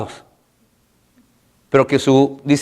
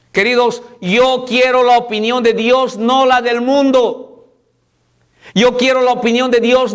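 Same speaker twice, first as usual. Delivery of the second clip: raised, insistent pulpit voice; full-force preaching; 8 semitones higher than usual. Acted. Pastor preaching to the church about trusting God, raised and insistent. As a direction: drive each parallel line home.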